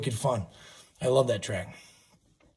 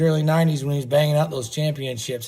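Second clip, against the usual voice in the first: ordinary talk, nothing marked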